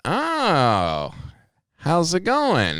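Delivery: slow, high, drawn-out hello; knowing greeting. Retold or imitated. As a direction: stretch the greeting out teasingly. Acted greeting to the customer.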